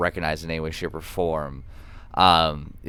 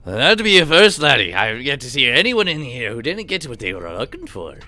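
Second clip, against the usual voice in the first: high, loud jovial Scots brogue; a hearty old shopkeeper teasing a customer, laddie; Scottish shopkeeper; 6 semitones higher, 6 dB louder than usual. Acted. Shopkeeper chiding a frustrated customer, in a hearty Scots voice.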